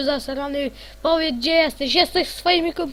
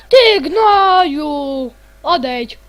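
louder, slow, drawn-out insult; angry shout; 3 semitones higher, 10 dB louder, much slower than usual. Real, not acted. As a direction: yell it out slowly at the pest. Yelling at a hostile mob. (przeciągnięty, gniewny okrzyk)

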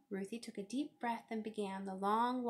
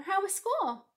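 high, loud greeting; sing-out parental hello; cheery mother; 11 semitones higher, 6 dB louder, slower than usual. A narrator's reading. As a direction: greet your child in a lifted voice. Mother greets child after school.

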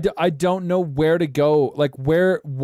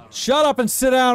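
annoyed snap; 7 semitones higher than usual. Real, not acted. Yelling at game character.

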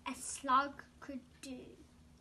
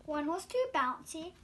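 usual speaking voice

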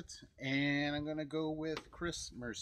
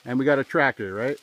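calling out; 2 semitones higher, 17 dB louder than usual. Real, not acted. Calling out to Dad outdoors.

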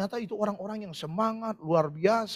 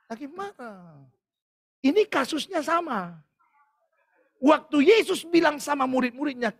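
ordinary delivery

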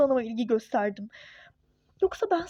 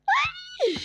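high delighted shriek; a sharp high yelp of delight; joyful squeal. Acted. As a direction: squeal with sudden joy. Wife squeals at happy news.

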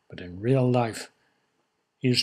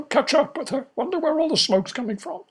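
very high parrot voice; 14 semitones higher than usual. Acted. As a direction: squeak it like a parrot.

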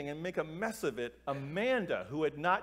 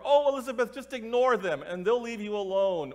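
higher, louder mock-teacher brush-off; dismissive teacher; 6 semitones higher, 7 dB louder than usual. Teachers brushing off a student who asked for help, dismissive. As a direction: imitate a teacher waving away a complaint.

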